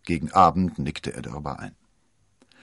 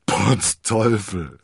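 louder, slow, stunned oath; astonished exclamation; 3 semitones higher, 6 dB louder, much slower than usual. A narrator's reading. D'Artagnan staunt über das Geld, ausrufend. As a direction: blurt it in amazement. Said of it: "rief D'Artagnan".

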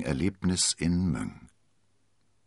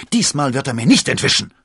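high, loud determined yell; shouting; 8 semitones higher, 11 dB louder than usual. A narrator's reading. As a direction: shout it as you take up the chase. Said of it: "schrie er". (entschlossen geschrien)

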